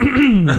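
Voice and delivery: loud imitated throat-clear; mock cough. Imitating the friend sitting there coughing, loud throat-clear. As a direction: clear your throat pointedly, acting it out. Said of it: "Sitting there coughing".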